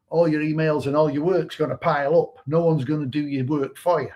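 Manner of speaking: ordinary talk, nothing marked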